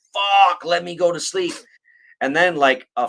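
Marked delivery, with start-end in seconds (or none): 0.1-1.5 higher, louder groan of a tired follower; mock exasperated cult member. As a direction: whine it like someone begging to sleep. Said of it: "and they're like".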